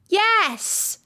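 put-on high, called-out girl's answer; shouted. A narrator's reading. Alice answers the Queen, calling out. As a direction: call back in a high voice. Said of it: "shouted Alice".

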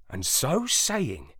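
plain reading voice

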